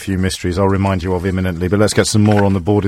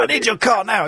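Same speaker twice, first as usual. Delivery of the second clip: policeman voice; 11 semitones higher than usual. Retold or imitated. Mock policeman demanding car.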